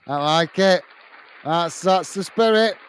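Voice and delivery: normal talking voice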